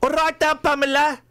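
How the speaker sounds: hammed-up Birmingham hello to Pamela; mock Brummie greeting. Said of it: "sound South African".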